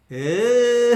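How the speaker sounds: loud drawn-out "eh" breaking into laughter; laughing. Real, not acted. Preacher laughing at the excuse he just quoted, laughing. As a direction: let a long laugh burst out after it.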